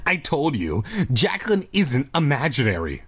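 ordinary delivery, nothing marked

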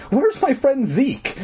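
high, slow, frightened demand; shrill demand. A narrator's reading. Girl demands her friend from the janitor, shrill and frightened. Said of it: "demanded in a shrill".

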